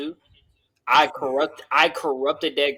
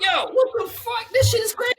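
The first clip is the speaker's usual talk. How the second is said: put-on telling how the girl really came, pitched way up in disbelief; amazed. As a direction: squeal it in amused shock. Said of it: "I was like".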